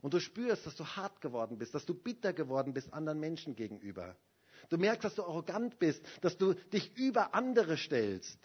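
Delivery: normal talking voice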